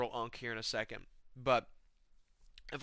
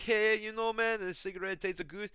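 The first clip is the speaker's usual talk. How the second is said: C P; 9 semitones higher than usual. Acted. Raving about a cigarette to friends, high hard-to-follow impression. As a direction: imitate a buddy who is tough to understand. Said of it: "sometimes you won't understand what he's saying".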